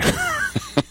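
short, high chuckle; brief amused laugh. Real, not acted. Host laughing at his own England joke, high.

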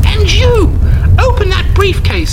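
high, loud granny barking orders; a sweet host turned menacing; commanding old lady. Acted. Hostess orders the ladies to brand the thief, menacing. Mrs Anders orders punishment.

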